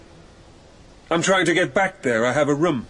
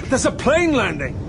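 higher, urgent warning; alarmed warning; 7 semitones higher than usual. Acted. Narrator warning Geoffrey of the landing plane, alarmed. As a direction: stop him before the plane hits.

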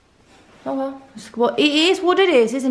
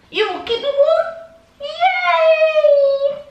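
much higher and louder, cheering the dog; 4 dB louder, much slower than usual. Real, not acted. Owner asking her dog to keep her warm, high cheering baby talk. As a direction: squeal happily at the dog.

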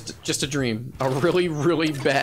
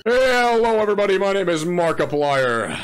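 quick, loud YouTuber intro greeting; Markiplier; 4 semitones higher, 4 dB louder than usual. Retold or imitated. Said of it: "Have you guys seen the Markiplier edit?".

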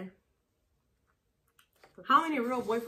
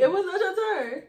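laughing protest; 9 semitones higher, 8 dB louder than usual. Real, not acted. Arguing over turns.

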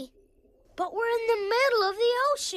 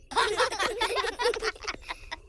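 high, rapid giggle; giggling laugh; 4 semitones higher than usual. Acted. Friends amused by Rocket's excitement, high giggle. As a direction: giggle in a bubbling run.

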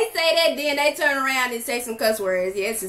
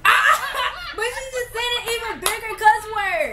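talking through real laughter; can barely get the words out for laughing; 6 semitones higher, faster than usual. Real, not acted. Laughing at mom cursing.

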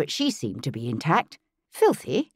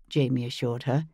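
ordinary delivery, nothing marked

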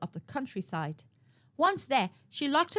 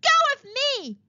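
much higher, louder shriek; 6 dB louder than usual. A narrator's reading. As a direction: shriek at the firefighters. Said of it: "shrieked Princess Reverie".

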